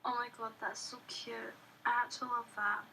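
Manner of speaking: ordinary talk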